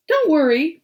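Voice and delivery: put-on high, bright-hearted boy hero; eager young Bertie. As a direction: reassure grandpa with eager pluck. Bertie reassures grandpa.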